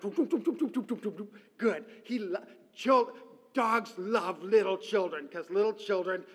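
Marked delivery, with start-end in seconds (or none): 0.0-1.3 quick, higher playful doop noises; dog eagerly eating scraps. Retold or imitated. Preacher imitating a dog snatching crumbs, playful doops. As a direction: mimic a dog gobbling up crumbs. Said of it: "the dog is like".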